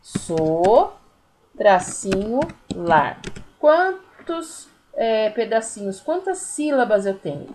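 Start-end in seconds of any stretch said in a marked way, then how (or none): 0.0-3.2 "So, tracinho, lar" in isolated loud beats; separated syllables. Shown as a demonstration. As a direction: dictate "So, tracinho, lar" with pauses at each break. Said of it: "Aí, eu vou separar".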